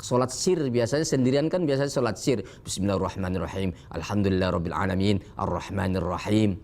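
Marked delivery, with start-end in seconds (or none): 2.7-6.6 rattled off quickly and low, like praying alone; fast hadar recitation. Shown as a demonstration. Teacher showing hadar tempo of solitary prayer, fast and low. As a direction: rush the verses in a fast murmur. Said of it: "Nah, seperti itulah".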